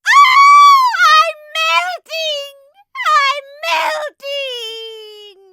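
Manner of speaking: shrieking witch in horror; horrified shriek. Acted. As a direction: wail it as you melt away. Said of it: "The Wicked Witch shrieked in horror".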